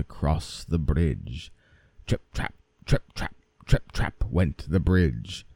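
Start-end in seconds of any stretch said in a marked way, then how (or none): none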